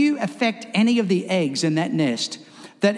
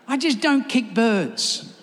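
usual speaking voice

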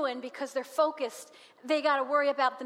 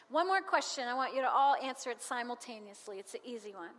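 ordinary talk

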